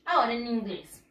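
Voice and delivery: ordinary delivery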